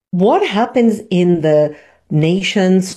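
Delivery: ordinary talk, nothing marked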